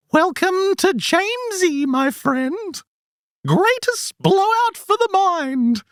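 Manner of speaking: very high, sing-song eunuch voice. Acted. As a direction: squeeze it up into a strange, high, sing-song creature voice. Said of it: "the weird goblin creature".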